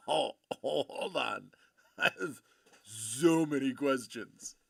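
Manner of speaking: stunned, drawn-out mock disbelief; reenacted starstruck reaction; retold astonishment. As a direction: stop everything, bowled over by the news. Reacting to astonishing band news in retelling.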